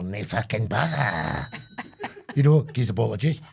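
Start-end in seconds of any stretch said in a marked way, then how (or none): 0.0-1.5 slow, smug and unbothered; smug customer. Pretend. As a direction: drag it out, totally cool. Running gag, slow.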